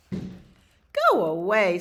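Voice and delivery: slow, loud dismissal; firm loud order. Acted. Man orders another dog to leave, slow and loud. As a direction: shoo it off loudly, drawn out.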